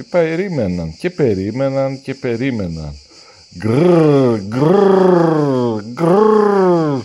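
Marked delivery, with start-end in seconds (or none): 3.6-7.0 a string of loud rough growls, climbing; the little monster keeps growling loudly; loud baby growls. A narrator's reading. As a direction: growl three times, rising and louder. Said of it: "το μωρό γρύλιζε και γρύλιζε".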